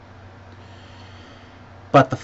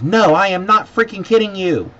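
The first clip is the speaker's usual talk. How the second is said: loud, high-pitched mock comeback; imitated angry retort; 11 semitones higher, 10 dB louder than usual. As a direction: throw the words back at the customer, high and loud. Airline guy retorts, retold.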